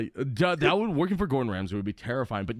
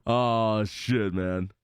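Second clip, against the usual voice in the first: ordinary talk, nothing marked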